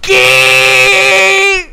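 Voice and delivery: very loud, high, drawn-out shriek; shocked scream. Real, not acted. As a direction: scream the word and hold it. Shocked by the reveal. (chillido largo de asombro)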